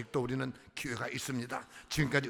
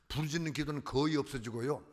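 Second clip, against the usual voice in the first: ordinary delivery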